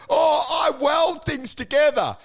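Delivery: high, derisive mimicry of a heckler; mocking mimicry.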